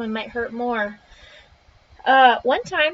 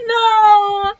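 loud, high cry of protest; frustrated shout at the game; 8 semitones higher, 8 dB louder than usual. Real, not acted. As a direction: yell no in dismay.

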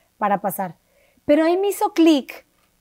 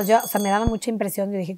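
usual speaking voice

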